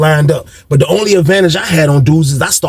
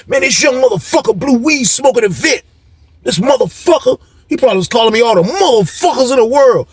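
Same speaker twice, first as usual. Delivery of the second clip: higher-pitched mock outrage; imitated angry rant; 7 semitones higher than usual. Inmate imagines Mister Brown fuming about him, mock angry. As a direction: act out an older inmate cursing someone out.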